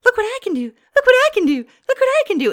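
high, loud, showy sing-song; mock TikToker voice. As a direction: show off like an eager TikToker. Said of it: "And it's like".

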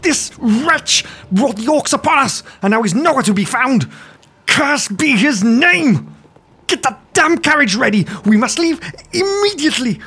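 high, raging noble shouting orders; shaking with anger. Acted. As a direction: shake with rage at the halfling. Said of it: "his voice quivering with anger".

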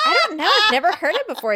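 high, loud flustered protest; flustered denial. Retold or imitated. Hostess answering the bartender, flustered and loud. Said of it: "And I'm like".

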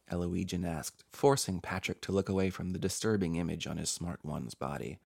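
usual speaking voice

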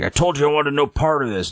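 mock indignant protest, pitched high; super angry. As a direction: complain crossly, imitating a reluctant performer. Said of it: "he's super angry".

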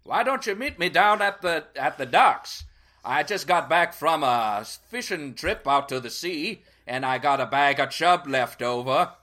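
a hammed-up Strawberry fisherman accent that keeps changing; shifting accent. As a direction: play a dockside fisherman whose accent wanders. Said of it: "your accent went through a whole thing".

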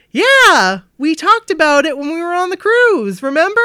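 the stupidity pouring out, chirpy and loud; blurting fan. Retold or imitated. Fan blurting to the singer about the cruise, loud and eager. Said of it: "And I say".

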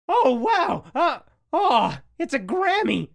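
high, loud mock exclamations; retold cartoon reaction. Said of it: "when the B Sharps win the Grammy".

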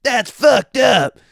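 slow high-pitched impression of an outraged street woman; imitating a street lady. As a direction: drag out the outrage like the alley lady. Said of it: "she's yelling this down the block".

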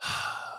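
long unvoiced sigh of disbelief; weary sigh. Real, not acted. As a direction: let out a tired sigh. Exasperated sigh at the clip.

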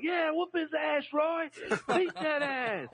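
high mock neighbors cheering from the porch. Neighbors on the porch egging the dad on, high hollering cheer. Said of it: "They was like".

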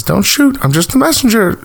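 high, squeaky messenger voice; mock high squeaky delivery guy. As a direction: chirp it like a nervous courier. Dennis the delivery guy quoted.

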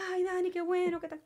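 excited congratulations, acted out; retold delight. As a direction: re-enact cheering a friend's good news. Retelling own reaction to good news.

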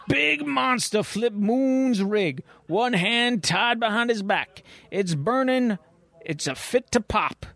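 slow, high, laughing hillbilly trucker drawl; a frantic trucker yelping the news, half laughing; panicked trucker. Acted. Trucker radioing troopers about the monster, slow high drawl.

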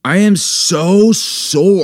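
slow, high, whiny groan; milk the soreness; drawn-out complaint. Real, not acted. Complaining about sore legs.

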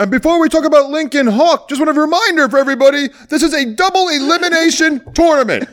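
high, fast announcer reminder; mock announcer. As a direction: rattle off the reminder like a hurried MC.

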